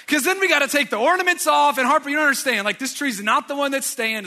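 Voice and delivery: loud, high-pitched protest; exasperated rant. Retold or imitated. Acted rant explaining refusal to child.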